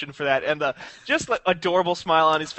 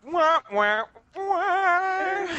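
sad trombone wah wah; 12 semitones higher, much slower than usual. Acted.

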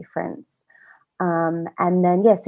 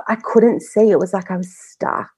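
ordinary talk

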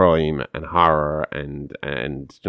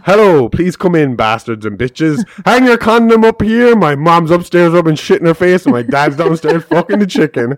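laughing punchline; 10 semitones higher, 9 dB louder than usual. Retold or imitated. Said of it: "The boy opens the door for him and says".

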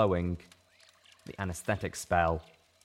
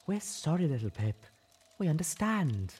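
gentle apology; 8 semitones higher than usual. Acted. Velvet gently apologising to Little Pip, tender. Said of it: "nuzzled me gently".

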